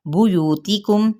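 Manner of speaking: slow recitation. Shown as a demonstration. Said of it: "teacher recites Quran word".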